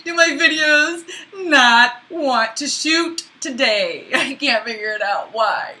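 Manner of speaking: half laughing, half crying; frustrated laugh-cry. Real, not acted. Vlogger frustrated at her failing videos, laughing and near tears.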